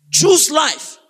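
high, punched-out proclamation; emphatic command. Real, not acted. Preacher closes scripture reading to congregation, emphatic high call. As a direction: ring it out over the room.

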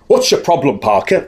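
a hint of a stern head teacher barking; light head teacher snap at the boy. Head teacher to a boy who bumped into him, lightly stern. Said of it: "Like head teachers do".

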